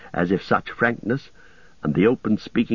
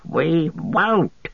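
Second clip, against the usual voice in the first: higher, defiant creature cry; cried out; 9 semitones higher than usual. A narrator's reading. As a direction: Gollum shaking his fist east. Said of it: "he cried".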